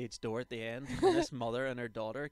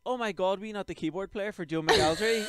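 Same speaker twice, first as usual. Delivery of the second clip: mock excited fan; 8 semitones higher, 11 dB louder than usual. Imitating the excited mother fan.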